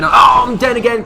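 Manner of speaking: high, loud panicked cries; panicking. Real, not acted. Gamer telling viewers he's panicking as he goes down, real panic. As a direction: let the panic rise into a shout. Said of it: "I'm panicking".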